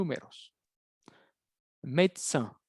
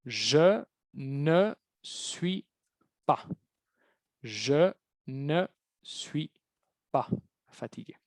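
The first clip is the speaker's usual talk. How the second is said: very slow, syllable by syllable "Je ne suis pas"; slow dictation; much slower than usual. Shown as a demonstration. Teacher dictating the negation to the class, very slow. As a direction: stretch out "Je ne suis pas fatigué" word by word. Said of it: "se va a decir".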